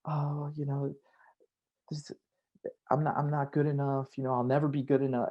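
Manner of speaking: a lightly acted insecure friend. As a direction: voice a friend who feels not good enough. Friend voicing self-doubt.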